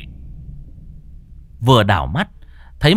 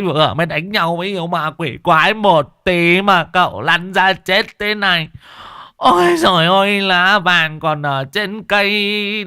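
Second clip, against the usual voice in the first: wailing lament; 10 semitones higher than usual. A narrator's reading. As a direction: wail over the young master like a mourner. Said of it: "thì mếu máo".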